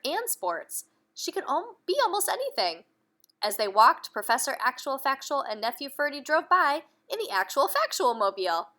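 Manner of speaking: put-on reading aloud to children; lively bedtime-story narration; storybook reading. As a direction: tell the story to little ones. Reading a picture book aloud.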